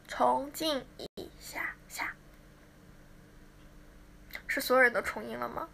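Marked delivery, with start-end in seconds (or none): 0.1-2.1 soft unvoiced whisper. Real, not acted. 对着调音的工作人员轻声耳语. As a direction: whisper the request to mute. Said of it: "两个人声音，重静一下".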